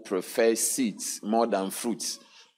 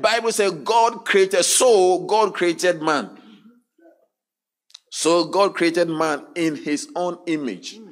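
loud, raised preacher's voice; preaching at full force; 7 semitones higher, 4 dB louder than usual. Acted. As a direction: drive the verse home to the crowd. Preaching the verse again.

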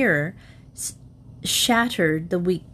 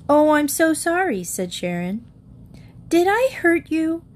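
lightly voiced apologetic child; sorry little girl; 7 semitones higher than usual. A narrator's reading. Sharon apologizing to her mother, lightly childlike. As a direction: plead sorry as a young girl.

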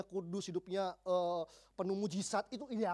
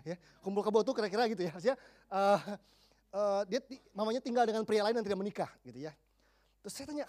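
ordinary talk

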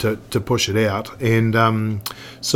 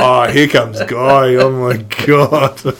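slow, drawn-out mock groan of recognition; mock dismay; 5 dB louder, slower than usual. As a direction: stretch it out, playfully dreading his arrival. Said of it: "I was like".

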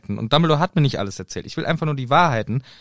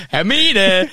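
calling out; 7 semitones higher, 7 dB louder than usual. Retold or imitated. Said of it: "Hermine schreit runter".

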